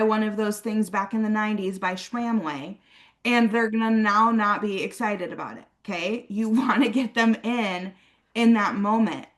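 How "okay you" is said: normal talking voice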